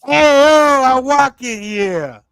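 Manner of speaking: put-on loud, slow, high New York accent; brash New York accent, dragged out. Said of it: "have you guys heard my New York accent".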